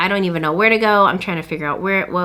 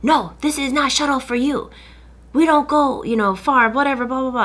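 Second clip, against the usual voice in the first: mock driver yell; 5 semitones higher than usual. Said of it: "yells at me".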